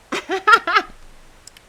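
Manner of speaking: a loud, high shriek of laughter; loud high cackle. Real, not acted. Streamer howling with laughter during the ad break. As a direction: let out a screeching laugh.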